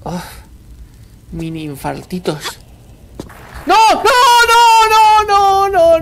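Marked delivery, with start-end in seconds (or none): 3.7-6.0 high, loud string of noes; panicked cries. Real, not acted. Player watching it go wrong, panicked cries. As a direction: cry no over and over in panic.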